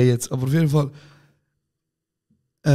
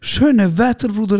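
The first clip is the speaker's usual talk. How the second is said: louder, higher cheery hello; mock cheerful greeting; 7 semitones higher, 4 dB louder, slower than usual. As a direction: greet him beaming, overdone. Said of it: "Er so". (übertrieben fröhlich und lauter grüßen)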